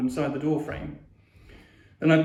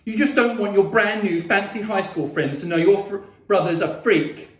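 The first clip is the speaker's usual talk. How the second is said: loud, raised bitter tirade; angry yelling; 5 semitones higher, 5 dB louder than usual. A narrator's reading. Boy accusing sister of being ashamed, yelling. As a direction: hurl the accusation at her. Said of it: "I yelled".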